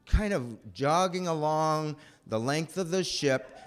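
ordinary talk, nothing marked

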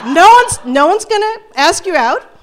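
high and loud, laughing while agreeing, imitating the friend; the friend cheerfully confirms the worst, laughing. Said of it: "And Regina's like".

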